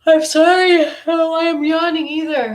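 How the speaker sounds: spirit voice greeting her. Retold or imitated. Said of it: "I was greeted with".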